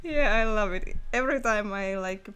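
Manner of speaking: ordinary delivery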